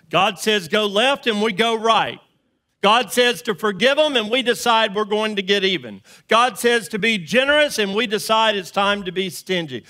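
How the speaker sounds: loud, high preacher's cry, hammering each line; fiery preaching. Acted. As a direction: preach it out to the whole church. Preaches at full force.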